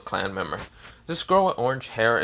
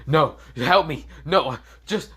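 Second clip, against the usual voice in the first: raised, higher-pitched mock plea; urgent calling out; 5 semitones higher, 5 dB louder, slower than usual. Orochimaru begging Naruto as he leaves, mildly acted urgent plea. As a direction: call out for help in short loud bursts.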